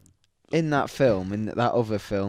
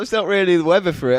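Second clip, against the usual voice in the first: high, quick, louder mimicked nurse; retold sceptical nurse; 7 semitones higher, 7 dB louder, much faster than usual. Nurse doubting the garden party excuse, quick high mimicked voice.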